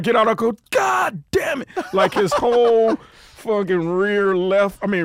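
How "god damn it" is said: high, drawn-out mock cursing; dismayed yell. Driver getting out to see the wrecked car, dismayed yell. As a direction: reenact a pained cry at the damage.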